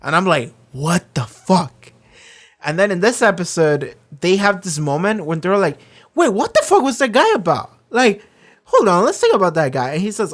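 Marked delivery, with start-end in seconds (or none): 0.7-1.9 slow baffled reaction. Retold or imitated. His own baffled reaction. 6.1-9.9 excited sudden realization. Retold or imitated. Characters realising something.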